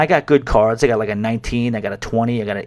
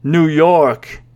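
drawn-out sceptical echo; stretched-out, doubting imitation; mock disbelief; 3 semitones higher, 4 dB louder than usual. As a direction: imitate a stranger doubting NEW YORK. Stranger doubts the answer.

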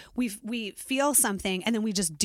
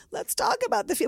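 eager anxious self; 12 semitones higher than usual. Retold or imitated. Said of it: "I'm just like".

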